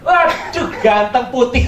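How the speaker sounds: high, loud gasp of delight; amazed waduh. Retold or imitated. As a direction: gush over the baby. Terkejut melihat bayi cantik. (seruan kagum yang dibuat-buat)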